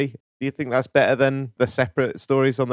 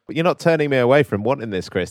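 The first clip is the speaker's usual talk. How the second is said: ordinary delivery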